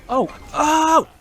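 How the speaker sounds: loud startled exclamation; surprised shout. Real, not acted. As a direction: yell out in sudden surprise. Surprise at big play.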